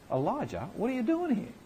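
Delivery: God demanding an answer, pitched high; God's voice. Acted. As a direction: call the prophet to account from above. God voiced questioning Elijah.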